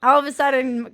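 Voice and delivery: ordinary talk, nothing marked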